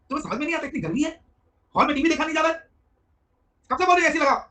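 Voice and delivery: wife on the phone scolding her husband about the heat. Retold or imitated. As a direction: tear into the husband over the AC.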